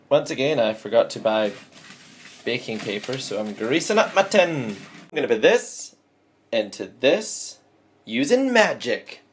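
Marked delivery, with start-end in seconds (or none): 4.7-9.2 slow dramatic reveal. Acted.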